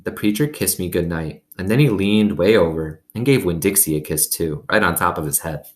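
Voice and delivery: ordinary delivery, nothing marked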